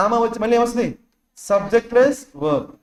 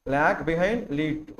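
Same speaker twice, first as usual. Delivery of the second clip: very slow and softer, drawing out every word; slow recap; 6 semitones lower, 6 dB quieter, much slower than usual. Shown as a demonstration. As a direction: drag each word for note-taking. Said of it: "ఓకేనా?". (చాలా నెమ్మదిగా)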